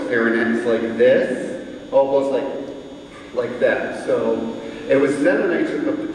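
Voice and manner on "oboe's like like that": usual speaking voice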